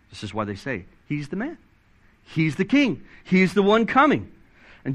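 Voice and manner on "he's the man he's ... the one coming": mildly acted crowd excitement, pitched higher; excited acclaim. Crowd hailing the promised king they have recognized, excited acclaim. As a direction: voice a crowd's rising realization, each claim building.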